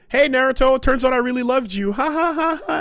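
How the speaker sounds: high, fast, girly mock confession; high mock Sakura. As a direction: play Sakura high and sugary. Sakura voiced by speaker.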